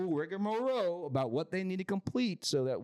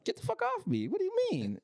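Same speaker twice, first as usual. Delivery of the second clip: squeaky, alarmed, much higher; very high startled mock voice. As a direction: act startled and back off. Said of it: "I was like".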